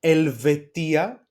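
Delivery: Helvetia drawn out slowly, E at the start; slow model word. Shown as a demonstration. Teacher modelling Helvetia for Switzerland, slow and clear. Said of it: "put some examples here".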